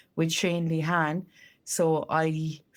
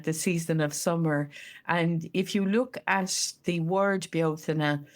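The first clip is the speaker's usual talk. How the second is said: ordinary talk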